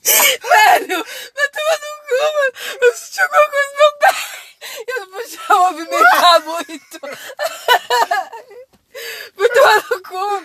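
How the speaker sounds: high, loud frightened shouting; scared yelling. Real, not acted. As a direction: yell out in fright, half laughing. Scared, yelling after jump scare.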